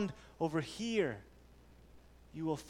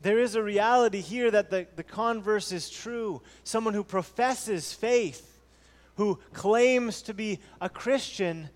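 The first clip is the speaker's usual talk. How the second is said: louder fervent pulpit delivery; preaching at full force; 4 semitones higher, 8 dB louder than usual. Acted. Pastor urging the church that God is supreme, fervent preaching. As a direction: drive the point home to the congregation.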